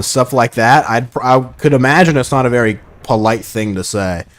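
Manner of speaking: ordinary talk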